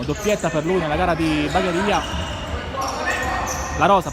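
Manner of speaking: usual speaking voice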